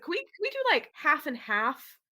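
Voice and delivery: high, tentative slow-ending plea; sheepish request. Retold or imitated. Director proposing compromise to actor, high tentative voice.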